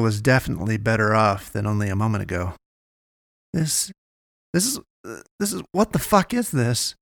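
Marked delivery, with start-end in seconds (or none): 3.5-6.9 much higher, stammering, rising to a cry; stammering alarm. Acted. Naomi confronting the strange eye, stammering fright. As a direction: stumble over the words in alarm.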